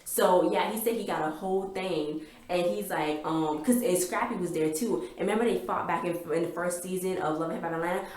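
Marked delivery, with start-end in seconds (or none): none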